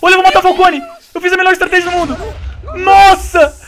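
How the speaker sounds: very high, loud victory yell; triumphant shout. Real, not acted. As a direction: crow over the kill. Triumphant yell killing enemy.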